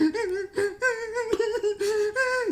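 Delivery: high falsetto mock sobbing; hammed-up crying. Josh alone on the yard, mock falsetto sobbing. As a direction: wail in tiny exaggerated sobs. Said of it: "Josh is crying and stuff".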